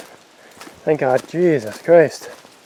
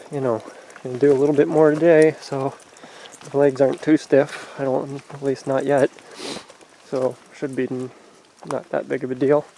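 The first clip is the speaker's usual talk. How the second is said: normal talking voice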